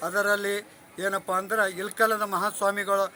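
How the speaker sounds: loud, measured public address; formal speech. Real, not acted. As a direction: announce it formally in short, separated phrases. Formal festival address to camera.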